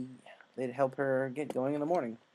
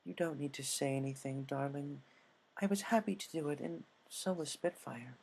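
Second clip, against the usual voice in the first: tender; 7 dB quieter than usual. Acted. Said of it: "gave her a gentle hug".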